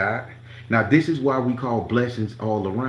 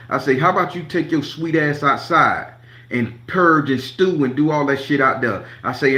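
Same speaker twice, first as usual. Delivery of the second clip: loud, heated telling-off; angry retold rant; 3 semitones higher, 4 dB louder than usual. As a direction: read him the riot act, loud. Retold argument with staff.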